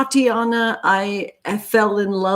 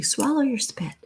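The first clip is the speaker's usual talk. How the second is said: a lightly voiced mother, higher and softer; a hint of mama scolding thirsty kids, a touch higher; imitating mama; 5 semitones higher, 7 dB quieter than usual. Quoting her mama to thirsty kids, lightly voiced and soft. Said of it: "Like my mama used to say".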